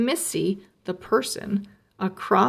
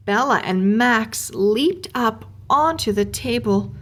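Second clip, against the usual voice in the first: usual speaking voice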